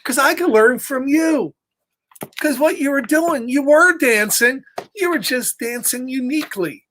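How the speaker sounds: a hint of a high cheerful Piggy; lightly voiced Piggy. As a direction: pitch it up a bit like an eager pig. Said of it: "Piggy says".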